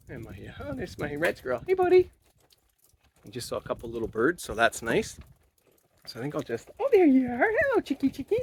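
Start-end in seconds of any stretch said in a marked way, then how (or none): 0.7-2.1 much higher, sing-song call to the birds; pet voice. Real, not acted. Calling out to the birds at sunset in a high pet voice. 6.8-8.4 much higher, sing-song call to the birds; pet voice. Real, not acted. Calling out to the birds at sunset in a high pet voice.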